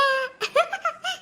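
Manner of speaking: high mocking cackle at the couple; mocking laugh. Goon laughs at the girl's lover, high mocking cackle. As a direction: laugh in his face, high and scornful.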